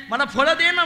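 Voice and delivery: high, loud pleading demand; insistent demand. Acted. Child Krishna to the fruit seller, demanding insistently. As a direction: press her to hand it over.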